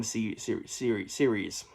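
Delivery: stuttered word, voice dropping away; stuttering. Real, not acted. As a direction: trip over the first syllable, restarting it. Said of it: "I'm stuttering for some reason".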